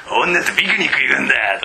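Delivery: a hint of a cram-school uncle making a pun; mild impression of the old summer-course teacher; imitating an old teacher. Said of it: "おっさんがさ".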